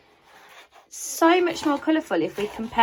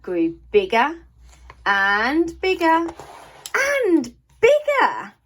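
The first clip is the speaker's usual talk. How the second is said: each bigger higher, louder and slower; building wonder; 7 semitones higher, 4 dB louder, slower than usual. A narrator's reading. Narrator tells children the garden keeps growing, building excitement. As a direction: let it swell as the garden spreads.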